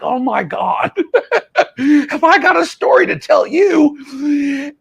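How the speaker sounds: mock gossiping operator, way up in pitch; gleeful gossip. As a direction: squeal over the juicy story. Imitating operator telling boyfriend.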